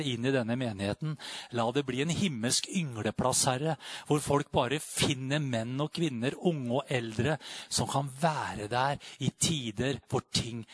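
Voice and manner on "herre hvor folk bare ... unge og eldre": ordinary delivery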